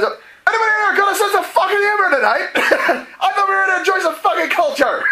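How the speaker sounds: high, loud shout breaking into laughter; laughing drunken shout. Acted. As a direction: roar at the room through your own laughter. And Morrow says.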